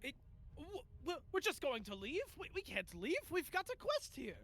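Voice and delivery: stammering, high-pitched protest; Quinn. Acted. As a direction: object loudly, pitch leaping up in disbelief. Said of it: "Quinn just turns and goes".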